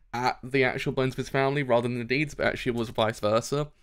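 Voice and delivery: normal talking voice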